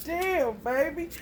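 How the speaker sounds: high, tickled laugh into the words. Real, not acted. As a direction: crack up, then speak through it. Amused by retold child's insult.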